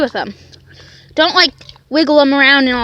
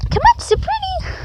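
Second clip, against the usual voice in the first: way up high, sweet-talking the dog into sitting; high coaxing call to the dog; 3 dB quieter than usual. Real, not acted. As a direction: coax the dog in a much higher voice.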